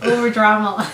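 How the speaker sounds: talking through laughter; giggling while speaking; laughing. Real, not acted. Wife laughing as she teases her husband about his retelling. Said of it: "O-, overdramatized it".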